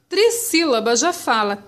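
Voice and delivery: usual speaking voice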